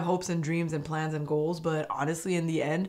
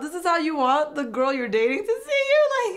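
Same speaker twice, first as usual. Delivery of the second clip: ordinary talk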